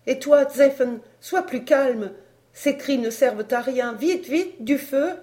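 high, loud, pressing orders; urgent command. Acted. Mother telling her daughter to calm down and hurry, loud and high. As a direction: push them to hurry. Sorley urging calm and haste.

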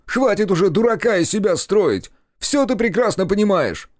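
high-pitched heated scolding; angry bark. A narrator's reading. Brother accusing the narrator of playing dumb, angry high shout. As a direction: lash out in exasperation. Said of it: "рявкнул он".

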